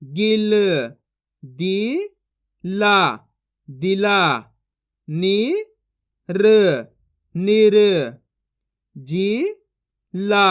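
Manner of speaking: each syllable said apart, then the whole word firmly; syllable drill. Shown as a demonstration. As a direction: chant it out like a reading drill for kids. (अक्षर-अक्षर जोड़कर पढ़ाने वाला अभ्यास)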